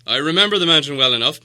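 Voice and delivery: loud, fast, proud dwarf king; proud dwarf lord. Acted. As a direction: brush off the map, pushing ahead. Thorin speaks about the mountain.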